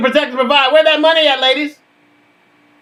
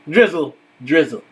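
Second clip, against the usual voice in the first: low catchphrase; 11 semitones lower than usual. Acted. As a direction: drop lower on drizzle drizzle.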